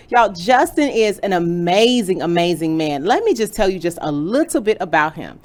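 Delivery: big hype host intro. Acted. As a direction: announce it like a show host. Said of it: "That's my intro voice".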